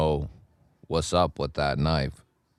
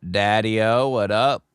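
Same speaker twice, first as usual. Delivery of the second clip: usual speaking voice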